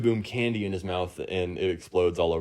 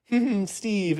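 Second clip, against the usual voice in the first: high, mock-swooning hum and name; lovestruck; 13 semitones higher than usual. Lovestruck girl tuning out a call about the boys, dreamy. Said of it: "crazy in love with Steve".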